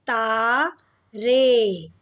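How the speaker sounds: ता, रे said apart as taa, ray; drawn-out syllables. Shown as a demonstration. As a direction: stretch the vowels in ता, रे. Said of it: "में ए का sound आ रहा है".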